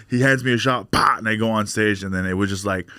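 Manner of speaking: ordinary talk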